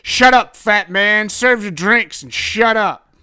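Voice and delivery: very loud, high, bullying shout; angry shouted order. Acted. Detective silencing the bartender, furious shout. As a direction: shout him down with full force.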